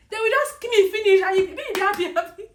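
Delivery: very high, very loud outburst; reliving a desperate shout; shouted protest. Retold or imitated. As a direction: yell it at God in outrage. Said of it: "then I shouted".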